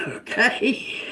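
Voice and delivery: high, laughed-out question; laughing. Real, not acted. Reader amused by own pep talk to sitters, laughing. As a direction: let a laugh break up the word.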